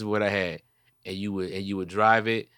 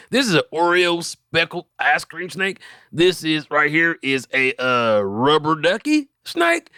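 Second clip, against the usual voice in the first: raised, hammed-up pitchman showing off his snakes; dubbed video voice; 4 semitones higher than usual. Dubbing a video for friends, proud snake-seller voice. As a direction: voice over a stranger's video like a proud salesman. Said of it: "Dubbing videos".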